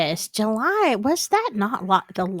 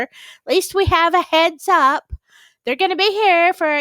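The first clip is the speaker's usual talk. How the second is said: mock excited; 7 semitones higher, 7 dB louder than usual. Real, not acted. Joking about aliens visiting.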